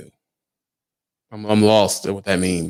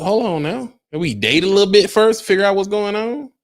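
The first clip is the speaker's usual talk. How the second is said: mock coy protest; 10 semitones higher than usual. Mock reluctant date.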